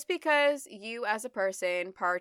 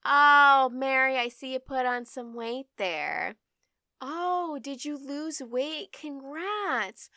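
higher, fake-sweet nosy relative voice; mock nosy relative; 4 semitones higher, 6 dB louder, slower than usual. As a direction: ham up a prying aunt, syrupy and sly. Mocking a relative's weight comments.

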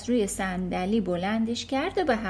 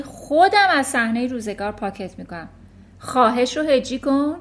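furious headmistress; 7 dB louder than usual. Acted. Headmistress Trunchbull bellowing at a small boy in fury. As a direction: berate the boy like a tyrant.

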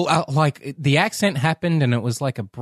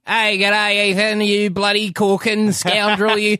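higher, hammed-up Australian greeting; mock Aussie accent; 5 semitones higher than usual. Parodying a Sydney welcome for Ethan, broad mock Aussie. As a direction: lay on a broad Australian twang.

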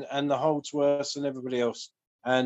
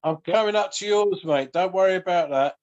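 higher and louder, joking promise to visit; excited banter; 7 semitones higher, 6 dB louder than usual. Real, not acted. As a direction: rib your mate with a cheerful boast. Joking he'll visit his friend.

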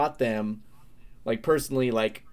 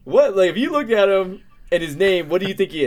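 much higher and louder, protesting; defensive outburst; 8 semitones higher, 7 dB louder than usual. Real, not acted. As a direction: plead your case loudly. Defending himself, voice jumps.

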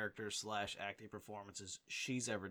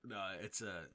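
ordinary delivery

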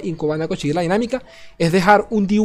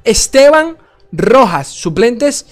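higher, louder hype reveal; excited announcer; 5 semitones higher, 6 dB louder than usual. Real, not acted. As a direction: announce the winner like a game-show host. Countdown and winner announcement.

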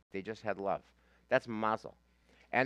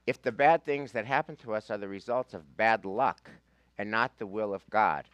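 reading aloud; 7 dB louder than usual. Shown as a demonstration. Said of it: "I wanna read a few".

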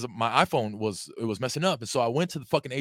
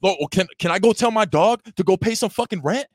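higher, louder mocking outrage; sarcastic furious quote; 9 semitones higher, 5 dB louder than usual. Mocking the landlord over dog rent, high loud sarcastic outrage. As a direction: spit out the sarcastic question, pitched up and loud. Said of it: "this is the angriest I've ever seen you".

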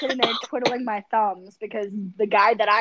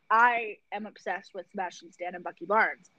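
normal talking voice

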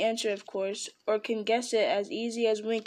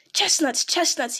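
higher, louder hiss of the words; hissing Gollum; 6 semitones higher, 4 dB louder, slower than usual. A narrator's reading. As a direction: spit it out like a creature. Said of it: "he hissed".

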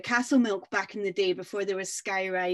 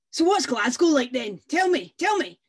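louder, pushy and higher; impatient loud demand; 6 semitones higher, 9 dB louder than usual. Acted. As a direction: demand it like a rude rider. Rude rider demands news.

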